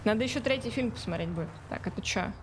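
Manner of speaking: ordinary talk, nothing marked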